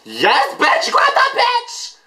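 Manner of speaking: very high shrieked cheer; drawn-out ecstatic shriek; excited screaming. Real, not acted. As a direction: scream it way up high like a wild fan. Screaming at the video.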